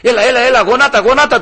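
high, loud urging, imitated; insistent uncle. Uncle urging his brother to kneel, insistent mock urging. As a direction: hurry someone along like an eager old uncle.

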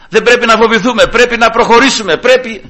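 high, loud rallying call; pulpit exhortation. Retold or imitated. Preacher re-enacting his call to go forward, exhorting.

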